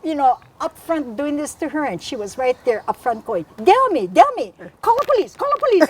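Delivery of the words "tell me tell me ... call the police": high, urgent mock yelling; acted high-pitched repeated demand; frantic confrontation. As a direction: cry out for police, pitched way up. Acts out her friend confronting intruders.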